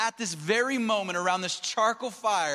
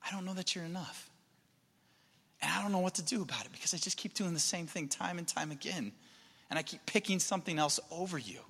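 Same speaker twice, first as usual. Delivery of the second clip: soft, subdued admission; hushed, troubled prayer; quiet confession; 7 dB quieter than usual. Acted. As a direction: confess it softly after a long hesitation. Acted confession of doubt to Jesus.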